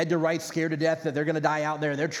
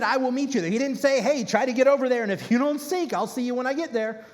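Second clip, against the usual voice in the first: preaching fervor; 6 semitones higher than usual. Acted. Preacher contrasting what God didn't say, fervent. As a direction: build it up, almost mocking.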